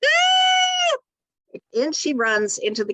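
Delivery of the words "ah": high loud shriek; a piercing scream as she bursts in. Retold or imitated. Mother shrieks running in.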